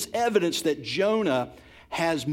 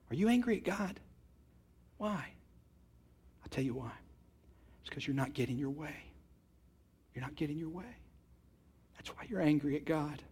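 hushed and pointed; 7 dB quieter, faster than usual. Real, not acted. Quiet pointed confrontation.

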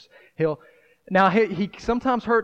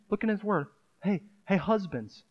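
ordinary talk

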